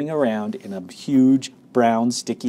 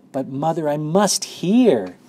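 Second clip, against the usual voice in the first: high, drawn-out plea; pleading protest; 6 semitones higher, 5 dB louder, slower than usual. A narrator's reading. Boy begging mother for more story at bedtime, pleading. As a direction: plead it, stretching the last words.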